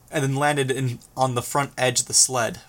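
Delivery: ordinary delivery, nothing marked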